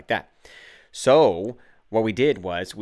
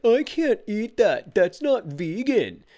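put-on high, slow child's voice of protest; kids protesting; 11 semitones higher, 3 dB louder, slower than usual. Dad voicing his kids refusing party food, high slow whine. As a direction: whine it like a small kid who won't eat. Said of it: "your kids are gonna be like".

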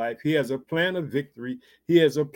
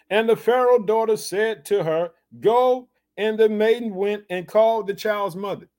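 lifted reading, "Go" higher and louder; scripture reading; 5 semitones higher, 3 dB louder than usual. Acted. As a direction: read with a sharp "Go". Preacher reads the scripture.

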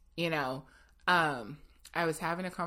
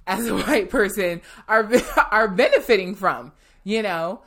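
louder, laughing through the words; smile in the voice; 3 semitones higher, 12 dB louder than usual. Real, not acted. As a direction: let real laughter break into the sentence. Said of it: "can hear the smile in my voice".

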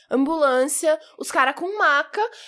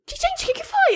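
high, puzzled asking; mock innocent surprise. Girl asking the rescuers what happened, high mock bewilderment. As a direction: play dumb, wide-eyed. Her innocent reaction to crowd.

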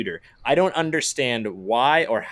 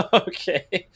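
laughing okay, voice jumps high; a real laugh of disbelief; 7 semitones higher, 3 dB louder than usual. Real, not acted. Laughing at the error.

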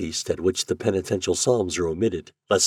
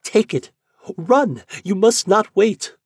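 put-on much higher, louder urgent girl; pleading Yuli; 13 semitones higher, 6 dB louder than usual. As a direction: push him out the door with your voice. Yuli begs him to run.

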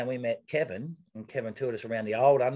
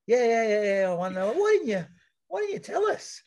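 very high, loud, mock-mortified squeal; embarrassed disbelief; 11 semitones higher, 4 dB louder than usual. Race newcomers asking the winner why he didn't tell them, in high embarrassed disbelief. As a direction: act out their embarrassed shock.

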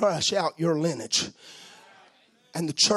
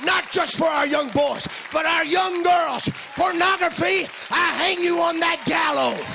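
laying a curse; 10 semitones higher than usual. Real, not acted. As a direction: curse it in Jesus' name. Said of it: "I curse you in the name".

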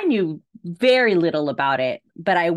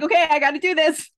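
laughing resolve; 9 semitones higher, faster than usual. Retold or imitated. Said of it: "So I'm like".

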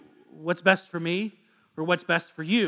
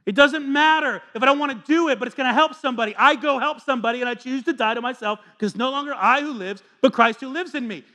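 louder, higher preacher voice, building; fiery sermon delivery; full-force preaching; 8 semitones higher, 7 dB louder than usual. Acted. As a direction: preach it out over the room.

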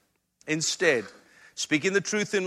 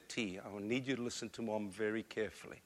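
a softer, lower voice, mother kneeling to her son; mother holding her son's face, speaking gently; soft, earnest mother; 7 semitones lower, 15 dB quieter than usual. Retold or imitated. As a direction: speak gently to a small child. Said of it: "she said".

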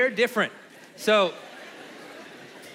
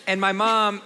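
usual speaking voice

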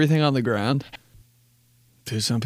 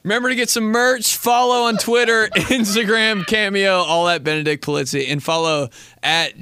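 podcast outro; 7 semitones higher than usual. Acted. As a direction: announce the outro with energy. Outro ad read.

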